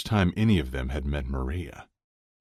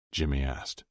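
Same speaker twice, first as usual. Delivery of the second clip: usual speaking voice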